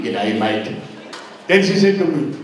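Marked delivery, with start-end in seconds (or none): 0.0-0.8 low, dropped "G'day, mate"; disapproving echo. Retold or imitated. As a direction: quote "G'day, mate" back in a much lower voice. Uncle echoes the greeting back, baffled.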